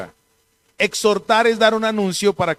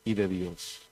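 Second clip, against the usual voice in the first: scripture reading; 4 semitones lower than usual. Acted. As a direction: read the verses with reverent weight. Reads scripture aloud.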